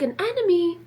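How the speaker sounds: high, loud startled question; high, loud alarmed "enemy?". Retold or imitated. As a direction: gasp it out as if attacked. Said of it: "I was like".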